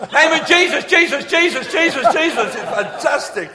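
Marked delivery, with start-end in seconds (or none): 0.1-2.6 very high, loud volley of repeats; machine-gun shouting. Retold or imitated. Bishop in Anglican church, machine-gun shouting. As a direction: blast the name out in repeated bursts. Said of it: "is like a machine gun".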